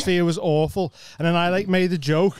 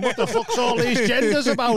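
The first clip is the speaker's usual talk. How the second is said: higher mock ranting bloke; clueless comic's rant; 8 semitones higher than usual. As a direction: lampoon the old guy's rant, pitched up. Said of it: "Just going".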